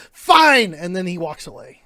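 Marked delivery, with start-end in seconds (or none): 0.1-0.7 loud sulky fine. Acted. NPC gives up.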